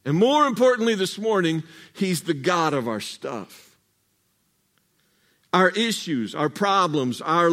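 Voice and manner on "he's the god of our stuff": ordinary delivery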